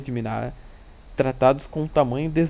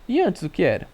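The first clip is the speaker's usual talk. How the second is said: higher, slightly mischievous question; touch of malice; 5 semitones higher, 3 dB louder than usual. A narrator's reading. Narrator questions the messenger to catch out his friend, slightly malicious. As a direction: ask with a sly edge. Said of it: "com uma ponta de malícia".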